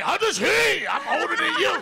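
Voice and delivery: high, loud mocking yell of the address; rude mock shout. Mocking the disrespectful younger comic, loud shouted address. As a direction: shout it like a cocky younger guy. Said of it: "You can't be like".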